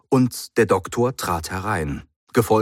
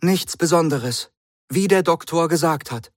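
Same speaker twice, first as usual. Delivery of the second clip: a higher voiced Miss Grant; lightly voiced young woman; 10 semitones higher, 3 dB louder than usual. A narrator's reading. Miss Grant backs up the Doctor, voiced higher. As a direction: echo the Doctor, eager to agree.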